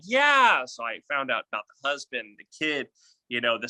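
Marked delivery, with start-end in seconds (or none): 0.0-0.7 high, loud eager yeah. Retold or imitated. Coach mimicking trainee's high, eager yes. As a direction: burst out agreeing. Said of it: "And you're like".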